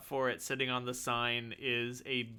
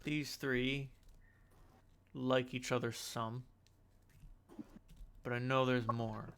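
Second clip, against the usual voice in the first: hushed in-character storytelling; cryptic game character; 3 dB louder than usual. Acted. Game master voices character, narrates.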